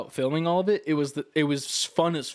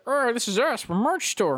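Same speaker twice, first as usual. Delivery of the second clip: high, bright salesman pitch; hammed-up merch pitch; cheesy announcer voice; 10 semitones higher than usual. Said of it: "like, the".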